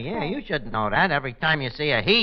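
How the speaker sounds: usual speaking voice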